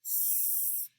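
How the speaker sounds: just the sound of S, hissed; isolated S hiss. Shown as a demonstration. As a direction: say S as a long unvoiced hiss, sss. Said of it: "we'll make the ending sound".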